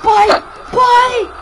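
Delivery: high shouted call. Pretend. Kid shouts for his father to come. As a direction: yell for dad from far away.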